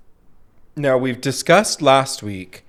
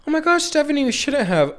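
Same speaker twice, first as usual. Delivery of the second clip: very high, gushing mock thanks; gushing gift receiver. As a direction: squeal thanks over a beautiful gift. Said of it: "like, a bit".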